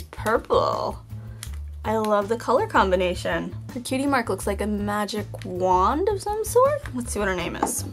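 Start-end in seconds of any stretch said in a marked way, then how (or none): none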